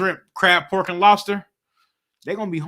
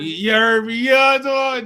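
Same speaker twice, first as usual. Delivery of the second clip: pitched up and louder, fired back; excited outburst; 7 semitones higher, 8 dB louder, faster than usual. Real, not acted. Answering his partner's you heard, loud and excited. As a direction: push it up loud and high, all worked up.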